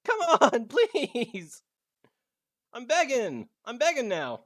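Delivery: high loud begging; pleading with a machine. Real, not acted. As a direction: beg it with a laugh. Begging frozen game.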